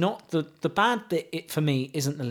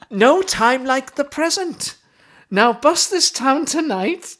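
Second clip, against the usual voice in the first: Welsh accent; 8 semitones higher, 4 dB louder than usual. Shown as a demonstration. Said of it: "Are you gonna do it with the accent?".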